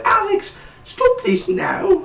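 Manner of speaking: very high squeaky mock mom voice; shrill mock mom. Recapping the show, mocking mom in a squeaky high voice. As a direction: ham up the mom way too high. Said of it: "She's not, she don't sound like that".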